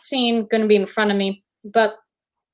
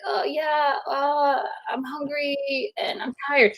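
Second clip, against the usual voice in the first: higher, soft half-hearted whine; feigned hunger, tiredness; 4 semitones higher, 6 dB quieter, slower than usual. Retold or imitated. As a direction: feebly fake being hungry and worn out. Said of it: "pretending. And I'm like".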